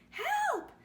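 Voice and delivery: very high, loud panicked shout; stranded frog yelling for help. Acted. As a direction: scream for rescue over the storm. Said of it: "he shouted".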